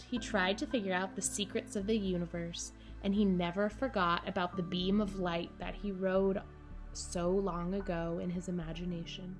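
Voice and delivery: plain reading voice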